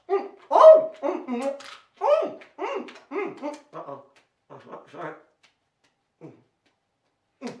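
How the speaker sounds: high hummed mumbles through a bite; mouth full. Pretend. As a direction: hum excitedly round a mouthful of apple. Mouth full of apple, juggling.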